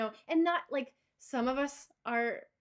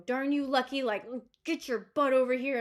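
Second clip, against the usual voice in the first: mild mock anger; 3 semitones higher, 5 dB louder than usual. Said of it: "I wanna be like".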